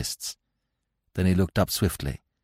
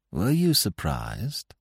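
slow, higher, loaded question; subtly changed tone; 6 semitones higher, 3 dB quieter, much slower than usual. A narrator's reading. Investigator probing the warder's story, subtly pointed. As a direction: draw it out with veiled meaning. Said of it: "subtly changed the tone of his voice".

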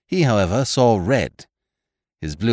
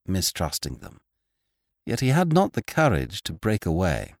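ordinary delivery, nothing marked

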